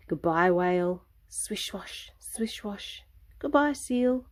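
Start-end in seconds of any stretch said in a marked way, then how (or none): 1.3-3.0 whispered water swish. Acted. Whale splashing again.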